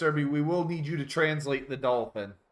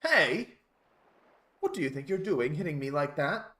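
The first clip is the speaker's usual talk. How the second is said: higher, properly enunciated British voice; prim and proper English; 3 semitones higher than usual. Acted. Dolphin's English translation of the scene, prim British. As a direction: play it prim and affronted. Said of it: "prim and proper as possible".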